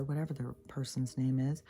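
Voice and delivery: normal talking voice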